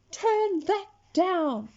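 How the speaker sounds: slow, high, loud order; angry yell. A narrator's reading. Dad still yelling at Henry.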